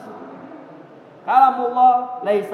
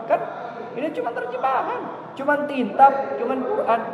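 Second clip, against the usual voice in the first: high sneering mimicry of a liberal; mocking the liberals; 8 semitones higher than usual. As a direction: pitch it way up to ridicule the claim. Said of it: "gitu kata orang liberal". (meniru orang liberal dengan nada tinggi mengejek)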